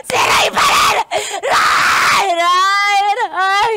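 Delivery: loud, high crying scream; drunk screaming. Acted. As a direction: scream his name through tears. Drunk friend screaming ex's name.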